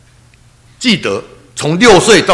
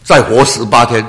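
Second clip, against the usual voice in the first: higher, louder pleading prayer; earnest prayer; 7 semitones higher, 6 dB louder than usual. Retold or imitated. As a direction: cry out to the Lord for mother's life. Kneeling, begging God for mother. (跪着大声恳求主让母亲活过来)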